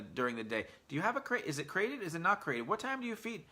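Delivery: high, quick, overeager house guest chatter; nonstop nosy guest. Pretend. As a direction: pester her like a guest who won't stop asking. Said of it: "pretend that I was in your house".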